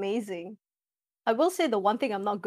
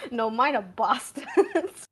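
laughing; 5 semitones higher, 5 dB louder, slower than usual. Real, not acted. Joking about own players, laughing.

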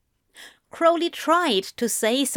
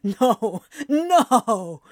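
dismayed character voice, rising on the repeat; appalled, half-amused refusal; dismayed protest. A narrator's reading. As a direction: beg the teasing to end. Groaning protest at cheesy lines.

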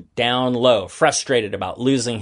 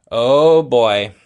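"Oh boy" louder, in a put-on character voice; 6 dB louder than usual. David dreading the talk about the closet, "Oh boy" louder in a kid voice. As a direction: groan out "Oh boy" like a kid in trouble. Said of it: "said David".

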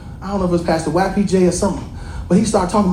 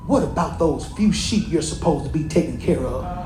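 lower, softer sneer at his little brother; scornful Eliab; 6 semitones lower, 5 dB quieter than usual. Acted. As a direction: belittle David's job with quiet scorn. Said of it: "He said".

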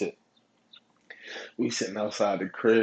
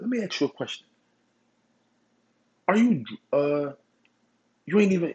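high, lightly voiced sergeant, pointed question; a hint of a stern sergeant turning the screw; calmed-down sergeant; 8 semitones higher, 4 dB louder than usual. Sergeant questioning a drunk soldier, lightly voiced stern question. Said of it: "he actually calmed down for a second".

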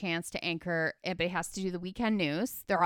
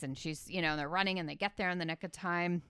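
ordinary talk, nothing marked

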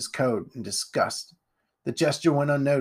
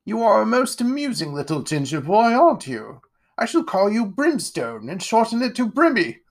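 higher, grand aristocrat voice; pompous lord; 10 semitones higher, 5 dB louder than usual. Acted. Lord Ironwood greeting Jack, pompous and grand. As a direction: puff yourself up like a self-satisfied lord.

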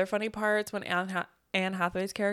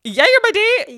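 loud high squeal, swooning; gushing squeal; 13 semitones higher, 14 dB louder than usual. Real, not acted. Swooning to cohost over the date scene, high loud squeal. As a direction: shriek it with delight.

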